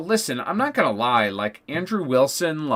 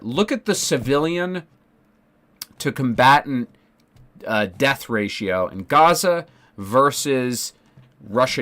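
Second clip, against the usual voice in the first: normal talking voice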